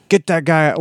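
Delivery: a boss snapping to throw him out; mock angry order. Villain Devlin ordering his men, mock angry.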